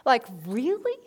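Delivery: marvelling to oneself, leaping up in pitch then slowing; acted-out astonishment at an idea; retold amazed thought. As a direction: act out wondering disbelief, ending on a questioning rise. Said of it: "I thought to myself".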